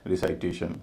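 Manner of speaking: "रिसाइटेशन" keeping the full "साइट" sound of recite; naive pronunciation. Shown as a demonstration. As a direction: say "रिसाइटेशन" as recite plus -ation. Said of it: "उच्चारण कुछ इस तरह होगा". ("रिसाइटेशन" में "साइट" का पूरा उच्चारण)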